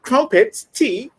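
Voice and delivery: high-pitched mock British accent. Man teasing his friend's fake British accent, mocking British. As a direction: exaggerate a British accent in mimicry. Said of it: "I hate your British accent".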